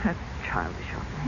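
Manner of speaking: whispered, almost to herself; ashamed whisper. Acted. Mother admits her childishness, whispered. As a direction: let the admission slip out in a breath.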